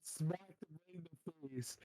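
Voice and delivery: ordinary talk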